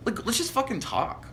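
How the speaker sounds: high-pitched, mock-pleading protest; exasperated plea. Guy begs his imagined date to just chat, exasperated.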